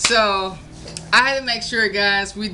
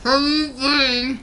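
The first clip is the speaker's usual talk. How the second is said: slow muffled words, crammed mouth; cheeks stuffed full; 5 semitones higher, slower than usual. Real, not acted. Stuffed mouth, chubby bunny.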